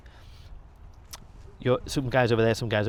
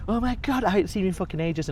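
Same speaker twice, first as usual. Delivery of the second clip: high, louder gushing; excited greeting; 9 semitones higher, 4 dB louder than usual. Retold or imitated. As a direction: gush with delight at an old friend. Liam grabs him, excited greeting.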